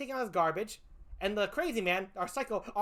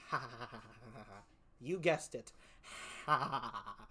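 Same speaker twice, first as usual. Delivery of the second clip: low, much softer sinister chuckle; a hint of a cartoon villain gloating; mock villain laugh; 10 semitones lower, 9 dB quieter than usual. Killer after blowing up the car, soft low mock villain laugh. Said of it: "and goes".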